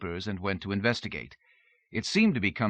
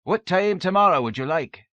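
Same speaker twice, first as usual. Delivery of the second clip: eager servant; 10 semitones higher, 8 dB louder than usual. A narrator's reading. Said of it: "said Angus".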